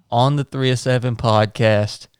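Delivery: usual speaking voice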